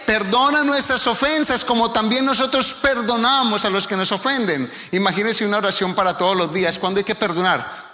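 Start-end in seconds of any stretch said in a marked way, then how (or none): 0.0-4.7 prayerful recital. Acted.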